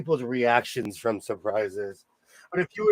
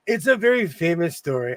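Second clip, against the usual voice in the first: normal talking voice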